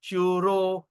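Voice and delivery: shuro with a long U and long O; pulled vowel. Shown as a demonstration. Teacher shows the naturally long O, drawn-out demo. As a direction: draw out both vowels of shuro. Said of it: "but you pull the raga".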